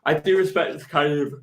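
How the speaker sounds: big yawn mid-sentence. Real, not acted. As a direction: talk through a yawn. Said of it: "that was a big yawn".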